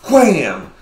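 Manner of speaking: a slow, loud wham; mock slap sound. Showing how the slapper swung, a slow loud wham. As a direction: stretch the hit out loud. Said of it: "She goes".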